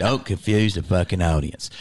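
very low imitated manager voice; manager's gruff advice. Manager warning a young comic, imitated low gruff voice. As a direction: drop right down and lay down the rule. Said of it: "He was like".

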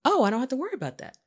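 high, rapid, relieved echo; surprised relief. Retold or imitated. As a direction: take in reassurance with pleasant surprise. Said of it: "made me feel secure".

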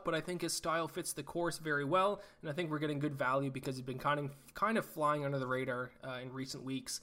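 ordinary talk, nothing marked

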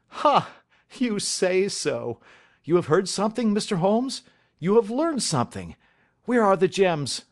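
eager hope. Acted. Banker pressing Holmes for news of the gems, eagerly hopeful.

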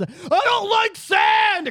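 high, slow jeering shout; mocking yell. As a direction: yell it across the street to taunt him. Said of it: "How many people probably yelled".

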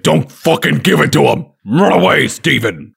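hammed-up warning in a put-on voice; Liam Neeson yelling. Yelling at Steven to run away, mock urgent yell. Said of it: "being yelled at by Liam Neeson".

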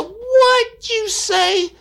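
high, slow, stunned; shocked old lady. Retold or imitated. Woman on the phone can't believe what the caller said, slow and stunned. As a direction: let the shock stretch the words out.

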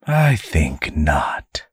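whispered demon menace; a demon quietly denies the angel; menacing demon. Acted. Demon answers softly.

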